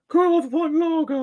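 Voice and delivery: very high, thin little cartoon voice; high-pitched cartoon creature asking for a pint; squeaky string voice. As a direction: squeak it like a tiny piece of string. Second string orders.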